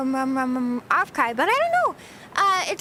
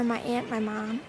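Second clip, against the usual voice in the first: ordinary delivery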